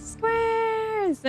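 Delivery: high, stretched-out squawk; very high mock shout; quoted catchphrase squeal.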